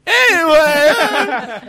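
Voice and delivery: high, loud, slow drawn-out bellow; drunk yell. Retold or imitated. Drunk friend heckling the singer, slow loud howl. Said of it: "Full level voice, as loud as he can".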